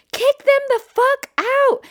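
high, loud, stretched-out order; mock outraged girl yelling; imitated furious shriek. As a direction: scream it at security, slow and high. Acts girl shouting to security guard.

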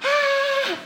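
high shrill bird scream, mimicked; imitated curlew scream. Telling a friend how the curlew sounded, a high shriek. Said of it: "it just sounds like someone screaming".

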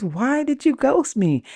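put-on high caller voice; imitated complaining caller. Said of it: "He goes".

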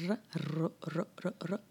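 r rolled on the tongue tip, trilled. Shown as a demonstration. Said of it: "you can roll the R".

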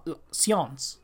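"la science" a la francesa, más bajo; French pronunciation. Shown as a demonstration. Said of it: "en francés es la". ("la science" pronunciado en francés)

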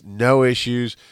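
ordinary talk, nothing marked

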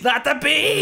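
high hammed-up shriek; mock scream. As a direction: scream it like Nicolas Cage. Quoting famous movie scream.

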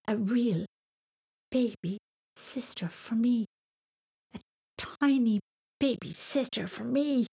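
put-on halting, wide-eyed little boy; awed wonder. A narrator's reading. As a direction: savour each word in disbelief. Boy awed by news of sister.

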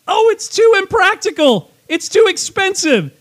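high, hammed-up motivational speaker; Matt Foley-style Cory Booker. Host parodying Cory Booker on the Green New Deal, fake outrage. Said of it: "He sounds like Matt Foley".

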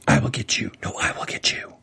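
hushed whisper imitating two rivals; mock whispering. Host mocking characters who whisper thirty feet apart, whispering. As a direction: whisper it like a secret threat. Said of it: "Are we talking about the whispers".

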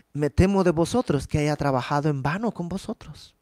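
ordinary talk, nothing marked

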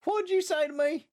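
high-pitched mock Southern accent. Newcomers baffled by strangers, mock Southern accent. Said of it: "talking in a Southern accent".

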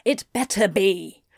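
understated hiss; a mildly hissed threat; hissed warning. A narrator's reading. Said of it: "Anthony hissed behind him".

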